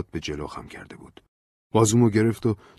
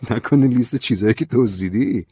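playful, louder mocking guess; joking tease; 5 semitones higher, 8 dB louder than usual. A narrator's reading. Narrator joking with Ben about his papers, teasing. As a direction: poke fun at him with a grin. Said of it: "به شوخی گفتم".

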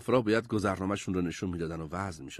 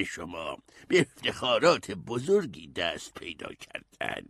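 put-on slow, measured aristocratic old man; unhurried old gentleman voice; kindly noble old man; slower than usual. A narrator's reading. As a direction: address them kindly, drawing out each phrase. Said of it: "با مهربونی".

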